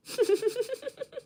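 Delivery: short high-pitched giggle; breathy little laugh at herself. Real, not acted. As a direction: let a squeaky laugh escape. Laughs at her accent guesses.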